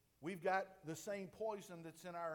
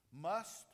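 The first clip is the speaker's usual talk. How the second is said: "Must" hit hard and loud; stress on must; 7 dB louder than usual. Shown as a demonstration. Preacher stressing "Must" to the congregation. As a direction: land on "Must" like a hammer. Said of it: "underline that word must".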